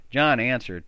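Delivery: ordinary delivery, nothing marked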